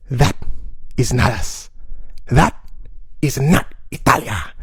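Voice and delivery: put-on low, slow, firm Italian accent; Italian man. Stranger steps from the crowd, slow low Italian accent. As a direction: stand up for your country, in a heavy Italian accent.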